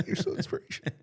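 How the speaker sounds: breathless teasing laugh. Real, not acted. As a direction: say it while laughing.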